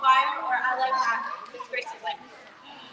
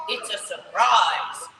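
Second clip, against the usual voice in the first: Daddy Pig voice; 6 semitones lower, 5 dB louder, slower than usual. Acted. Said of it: "says Daddy Pig".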